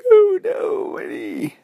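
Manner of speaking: high mock-crying little girl; hammed-up childish whimper; whiny wailing. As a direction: wail it pitifully, starting way up high. Girl wails over scratch.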